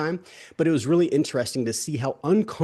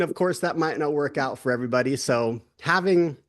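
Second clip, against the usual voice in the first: ordinary talk, nothing marked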